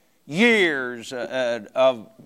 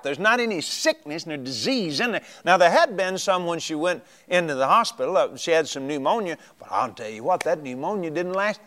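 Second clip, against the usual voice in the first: usual speaking voice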